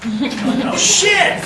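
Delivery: a hammed-up startled groan from the person being served; mock surprise. Teacher acting someone just handed a summons, mock shocked.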